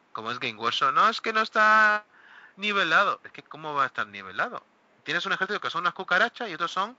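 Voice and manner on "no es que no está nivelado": higher, louder complaining whine; mock whine. Games Workshop player complaining about balance, whining loudly. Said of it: "en un juego se llora".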